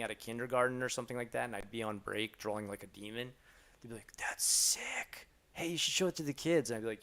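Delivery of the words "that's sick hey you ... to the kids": lightly acted enthusiasm, pitched up; voicing a keen colleague; excited coworker. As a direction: imitate an impressed coworker, high and eager. Voicing delighted Japanese coworkers.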